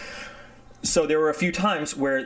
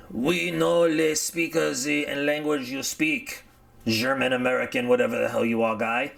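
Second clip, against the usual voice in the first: mock French accent; 3 semitones higher than usual. French soldiers answering the pilot.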